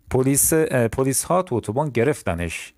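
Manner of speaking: ordinary delivery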